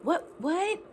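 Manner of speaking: imitating her dad in disbelief at the news; dad freaking out. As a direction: play the stunned dad who can't take it in. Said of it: "he's, like, freaking out".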